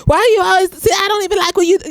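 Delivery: mock a partner who only criticizes; mock nagging complaint. Imitating a critical partner, a whiny nagging complaint.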